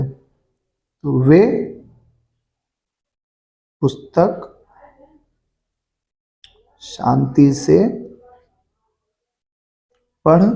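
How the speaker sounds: word by word, long pauses between; slow dictation. Shown as a demonstration. Teacher dictating the answer to pupils, slowly word by word. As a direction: dictate it slowly so kids can write. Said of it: "बन गया बेटा अपूर्ण भूतकाल".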